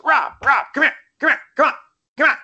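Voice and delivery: high, urgent agent calling someone over; John Levin impression. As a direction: imitate the agent in short, high-pitched bursts. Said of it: "And so John goes".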